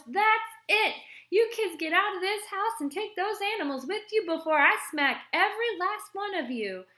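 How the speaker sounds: high, loud furious holler; angry hollering. A narrator's reading. Aunt throwing the kids out of the house, hollering. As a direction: snap and bellow at the kids. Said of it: "she snaps and hollers".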